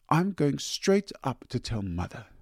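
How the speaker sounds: put-on high, tattling little-girl threat; childish threat. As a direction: threaten like a child going to tell. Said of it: "just as they had used to say".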